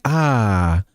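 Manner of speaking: long AH falling low, no R; Boston ah sound. Shown as a demonstration. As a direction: open up a Boston AH. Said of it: "It's the ah sound".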